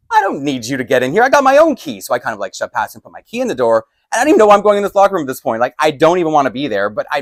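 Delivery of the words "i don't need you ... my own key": rising to a loud, high finish; defiant retort. Retold or imitated. Refusing his help at the locker door, defiant.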